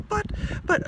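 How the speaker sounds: very high sputtering objection; stunned protest. Acted. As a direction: stammer a protest in a squeaked-up voice. Dick protests doctor's refusal.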